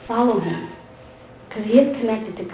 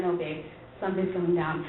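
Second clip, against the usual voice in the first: much softer and lower, hushed warning; quiet, grave husband; 6 semitones lower, 10 dB quieter than usual. Retold or imitated. Husband warning his wife in the car, hushed and grave. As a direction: drop the voice like bad news is coming. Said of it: "He's like".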